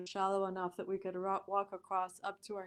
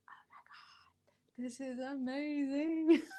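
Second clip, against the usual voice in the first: slow, breathless whisper breaking into a laugh; hushed, slow, amazed whisper with a teary laugh; awed whisper; 6 semitones higher, 3 dB louder, slower than usual. Retold or imitated. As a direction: whisper it in wonder. Awed exclamation leaving the place.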